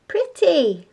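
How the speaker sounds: put-on high, cooing toddler word; baby voice. A narrator's reading. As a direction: say it like an admiring baby. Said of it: "said the baby".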